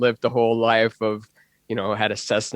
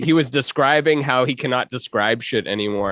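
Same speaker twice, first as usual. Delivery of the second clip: ordinary talk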